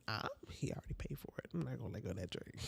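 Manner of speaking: fast whispered aside to self; whispered inner thought. Retold or imitated. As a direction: mutter it quickly in your head. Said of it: "in my head".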